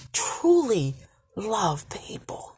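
truly love people, fading to a whisper; hushed whisper. Real, not acted. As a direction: drop to a breathy hush. Repeated truly, fades to whisper.

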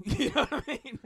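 laughing loudly, pitch jumping up. Real, not acted. Guest laughs at his own cop story, loud and high. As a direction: burst out laughing at the punchline.

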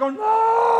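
high whining protest; mock whine. Believer whining at being dragged, high. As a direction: whine it like a reluctant kid.

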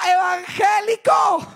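long, high mocking crowd call; imitated shout. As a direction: shout it out drawn-out like a heckler. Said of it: "la gente me gritaba". (grito burlón imitado, alargado y agudo)

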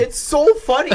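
high, loud, laughing delight; tickled. Real, not acted. Podcaster delighting in a cartoon joke with friends, tickled. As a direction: gush it, voice lifted by laughter. Overcome by the funny episode.

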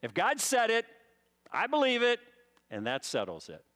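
"said" and "believe" punched high and loud; emphatic slogan. Shown as a demonstration. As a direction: hammer "said" and "believe", drop on "that settles it". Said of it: "Do you believe that?".

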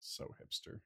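under the breath, mocking; whispered tease. Real, not acted. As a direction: whisper it as a snide aside. Teasing aside.

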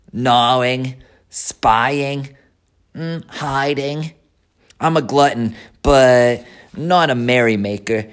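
sly, greedy rat; a crafty rat bragging. Acted. Templeton boasts of gluttony.

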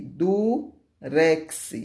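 "durex" split in two with a pause; segmented "du, rex"; syllable by syllable. Shown as a demonstration. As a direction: sound out "durex" as two pieces. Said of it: "Duas sílabas".

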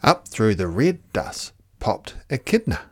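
ordinary delivery

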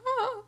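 high, short squeal of shock; a little yelp of dismay; mock squeal. Hearing Tony killed Bernardo, a high squeal. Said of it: "I was like".

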